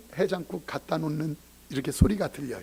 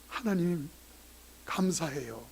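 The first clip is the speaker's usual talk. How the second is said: grateful whisper prayer; 8 dB quieter, slower than usual. Retold or imitated. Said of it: "보면서 목사가".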